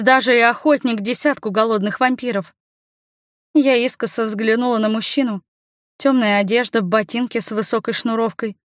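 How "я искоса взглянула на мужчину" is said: plain reading voice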